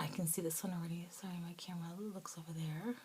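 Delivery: fast, hushed whispered aside. Real, not acted. Apologizing off camera about the camera, whispering. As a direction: whisper so the viewers barely hear.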